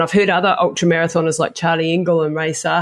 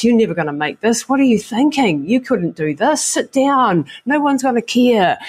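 lightly voiced mocking snake, rising higher; a hint of a sneering snake voice; taunting snake; 5 semitones higher than usual. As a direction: jeer like the snake in your head. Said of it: "the snake on the other side going".

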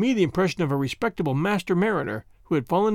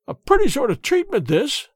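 put-on old man's high, raised protest; indignant old man, louder at the start; indignant cry; 8 semitones higher, 4 dB louder than usual. A narrator's reading. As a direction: cry out in affronted indignation, pitched up. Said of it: "he cried, stamping his stick".

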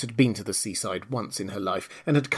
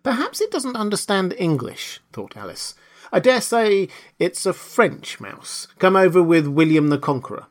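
higher, musing Alice voice; pondering Alice; 6 semitones higher than usual. A narrator's reading. Alice puzzles over the silent mouse, higher and musing.

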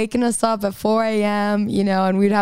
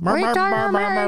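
much higher, put-on parent voice; mock parental tone; 10 semitones higher than usual. Singer repeating the line for the host, exaggerated mock parental tone. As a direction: exaggerate a high-pitched parental warning. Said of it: "Like, in that tone?".

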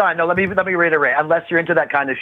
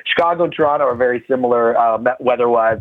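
ordinary talk, nothing marked

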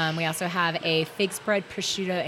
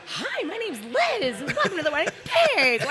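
high, slow, sing-song phone greeting; bright customer-service phone voice, pitched way up; sweet phone voice; 12 semitones higher, 4 dB louder than usual. Retold or imitated. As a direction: answer the shop phone all sugar. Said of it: "That's your phone voice".

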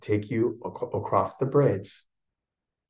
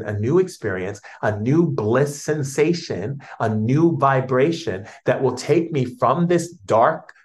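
raised, louder, beaming prayer; joyful prayer to spirit; 4 semitones higher, 6 dB louder than usual. Retold or imitated. Speaker praying to spirit each day, blissful and loud. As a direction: pour out blissful thanks to spirit. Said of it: "every day I go in and I say".

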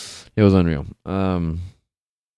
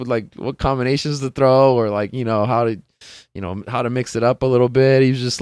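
ordinary talk

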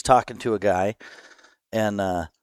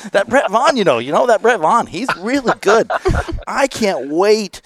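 put-on gushing fan, higher in a Wisconsin accent; 9 semitones higher than usual. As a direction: play an excited Wisconsin hunting guide. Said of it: "in the Wisconsin accent".

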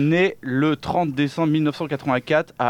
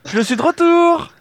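high, loud mock greeting call; squealed comeback announcement; loud high entrance; 13 semitones higher, 7 dB louder than usual. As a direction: burst in shouting a cheeky hello. Entrance shout to hosts.

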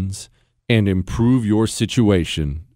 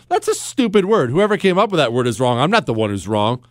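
ordinary delivery

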